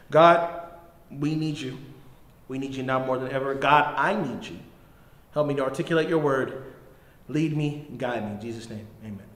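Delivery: soft, low prayer voice fading away. Acted. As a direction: pray quietly, drawing the room inward. Leads a short prayer.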